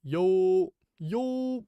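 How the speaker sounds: high, loud, sing-song yo yo; high playful greeting, trying the word out; hyped yo. Shown as a demonstration. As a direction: toss out a bright call of yo. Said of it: "Is it actually, "Yo"?".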